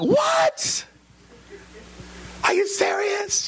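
high, stunned disbelief; shocked. Retold or imitated. Husband hearing his wife is pregnant, high shocked. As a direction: gasp it out in surprise. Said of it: "I was like".

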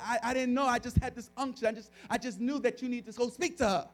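insistent, rising urgency; urgent insistence. Retold or imitated. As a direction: act out a husband pushing his wife to go. Retold urgent argument with wife.

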